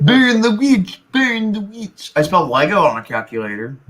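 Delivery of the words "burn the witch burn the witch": high, slow mob shriek; angry mob shout. Pretend. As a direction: screech it like a peasant mob. Monty Python mob chant.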